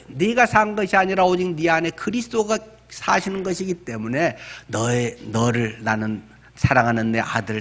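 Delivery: normal talking voice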